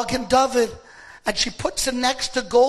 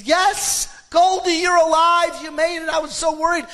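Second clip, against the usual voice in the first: overjoyed relief; 5 semitones higher, 4 dB louder than usual. Retold or imitated. Grandfather finds his granddaughter alive.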